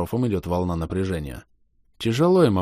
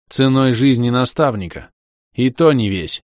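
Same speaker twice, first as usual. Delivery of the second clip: loud retort; 5 semitones higher, 7 dB louder than usual. Acted. Герой отвечает в гневе.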